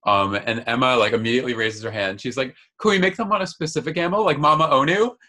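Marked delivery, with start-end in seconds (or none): 2.8-5.1 high, fast, eager; a keen student pleading with her teacher; eager question. Retold or imitated. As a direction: rush the question out in excitement. Emma eagerly asks teacher.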